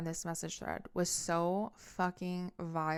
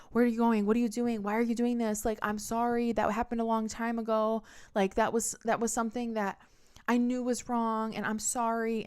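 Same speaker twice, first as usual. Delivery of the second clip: higher, louder retold pleading; pitched-up mock desperate apology; imitating frantic pleading; 5 semitones higher, 4 dB louder than usual. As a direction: mimic a boyfriend chasing with questions and apologies. Panicked boyfriend following her, retold.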